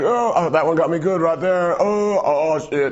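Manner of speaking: high, strained mock groan of pain; stabbed salesman. Knife show host jabbed in the chest, high pained groans. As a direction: play him just stabbed by his sword. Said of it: "He's like".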